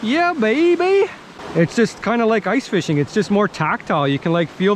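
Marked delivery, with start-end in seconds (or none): 0.0-1.1 excited bragging. Real, not acted. Bragging after the catch.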